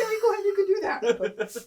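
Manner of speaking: high, loud voice breaking into laughter; squeaky-high quote through laughs; laughing mock reply. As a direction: imitate him in a laughing high pitch. Acting out coworker's reply, laughing.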